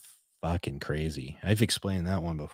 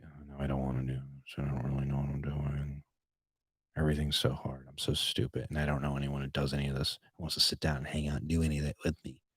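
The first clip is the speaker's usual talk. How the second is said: low, quiet mock whine to oneself; discouraged self-talk; 4 semitones lower, 6 dB quieter than usual. Vlogger voicing self-doubt over editing, low and discouraged. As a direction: mope softly, as if giving up. Acting out own discouraged inner voice.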